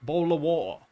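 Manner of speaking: "bowl of water" in a British accent, t dropped from "bottle". Shown as a demonstration. Streamer showing chat how Brits say it, British accent. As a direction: drop the t's like a Londoner in "bowl of water". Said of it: "We say".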